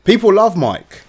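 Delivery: high, loud and gleeful; excited. Real, not acted. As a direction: announce the alter ego proudly. Joking about alter ego.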